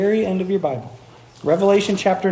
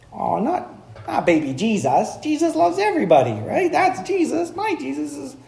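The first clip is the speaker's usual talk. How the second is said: high-pitched, gushy objection; hammed-up sugary defensiveness; mock naive protest; 8 semitones higher than usual. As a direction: whine it like a sentimental believer, put on. Mimics objectors defending gentle Jesus.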